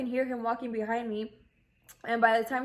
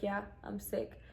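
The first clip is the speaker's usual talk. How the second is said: faint, tired reply; barely voicing a sick answer; weak and quiet; 8 dB quieter than usual. Weak, ill, answering stranger.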